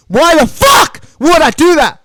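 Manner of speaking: high, loud disbelieving yell; loud incredulous outburst; incredulous shout. Real, not acted. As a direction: shout at your own stupidity. Mocking his own decision.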